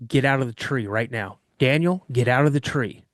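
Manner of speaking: usual speaking voice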